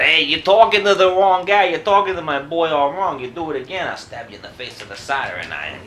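loud high tough-guy threat; mock threat. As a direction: puff up and threaten. Acted threat, quoted himself.

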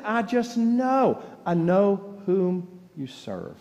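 each word slow and weighted; slow emphatic. Retold or imitated. Answering Carol with certainty, slow and weighty. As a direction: lean into every word slowly.

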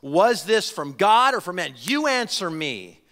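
slow, loud, commanding challenge; authority in voice. Retold or imitated. Jesus turning the question back on his challengers, authoritative. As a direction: demand an answer, each word drawn out with force. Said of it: "you can hear the authority in his voice".

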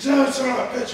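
high, loud frustrated curse; angry curse. Retold or imitated. Training partner swearing after being submitted. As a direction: spit it out like a sore loser. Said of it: "He's like".

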